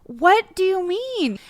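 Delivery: high, drawn-out mock bewilderment; baffled disbelief. The whole world reacting to her on TV, baffled.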